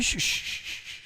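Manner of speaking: soft, repeated voiceless shushes; imitated shushing. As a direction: imitate a man hushing her, trailing away. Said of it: "the guy says that to her".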